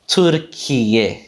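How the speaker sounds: Türkiye with puffed t and k; aspirated Turkish pronunciation. Shown as a demonstration. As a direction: say Türkiye as "tür-ki-ye", keeping the aspiration. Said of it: "Keeping that aspiration in there".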